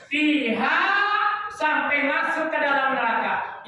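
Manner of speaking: slow, high, drawn-out verse recitation; emphatic recitation. Shown as a demonstration. Preacher recites the verse word by word, high and drawn out. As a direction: stretch each phrase high and slow. Recites and translates at full force.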